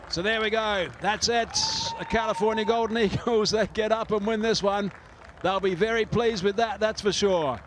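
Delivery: thrilled, rising winning call; excited. Real, not acted. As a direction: call the winning runs with delight. Match-winning runs called.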